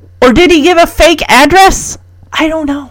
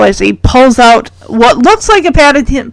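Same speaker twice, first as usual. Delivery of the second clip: usual speaking voice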